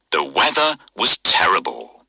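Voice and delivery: usual speaking voice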